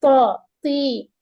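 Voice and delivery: تی with a full, heavy ط, mouth rounded; موٹا ط. Shown as a demonstration. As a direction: fill the mouth for the ط. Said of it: "منہ تھوڑی سی بھر کر پڑھیں گے".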